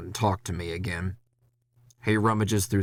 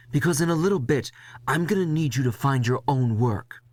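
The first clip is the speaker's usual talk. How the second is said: higher, scolding boss voice; lecturing boss; 5 semitones higher than usual. Acted. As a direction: give him grief like a stern boss. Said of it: "giving me grief".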